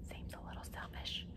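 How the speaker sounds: unvoiced, very soft whisper; whispered aside. Teacher whispering a remark about Robert to the class. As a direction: mutter it aside so he won't hear. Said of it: "I heard that".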